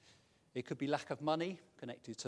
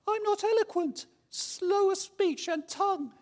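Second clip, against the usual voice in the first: much higher, louder anxious voice; whiny Moses; 10 dB louder than usual. Acted. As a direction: fret as a nervous Moses. Acting Moses in dialogue.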